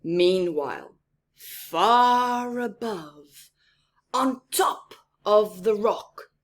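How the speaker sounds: loud, very slow, grand storybook build-up; dramatic narration. A narrator's reading. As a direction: announce the lion's world with weight. Lion section of story.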